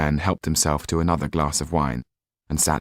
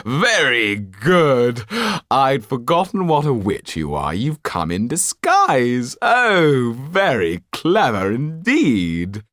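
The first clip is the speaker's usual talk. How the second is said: slow, louder, sarcastic drawl; mocking amusement; 5 semitones higher, 4 dB louder, slower than usual. Marquis mocking the Count's disguise, slow and sarcastic. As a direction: savour the joke at his expense. Marquis mocks, still laughing.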